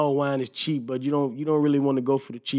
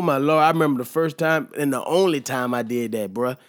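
usual speaking voice

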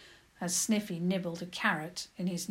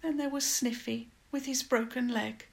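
put-on high-pitched, plaintive girl's voice; high pleading whimper, easing towards the end; 11 semitones higher, 4 dB louder than usual. A narrator's reading. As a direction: whimper out a helpless confession. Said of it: "She whimpered".

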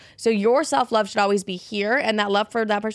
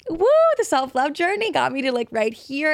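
excited whoop; 6 semitones higher than usual. Retold or imitated. Retelling her past overjoyed self.

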